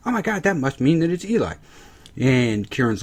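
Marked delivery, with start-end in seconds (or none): 0.1-1.5 higher, gasping mock realization; hammed-up Eli reveal; Emma quote. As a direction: play the shocked detective. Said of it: "And Emma's like".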